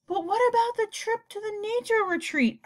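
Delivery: put-on high boy's voice, pleading. A narrator's reading. Boy asking principal about the trip, pleading. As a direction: plead like a disappointed kid.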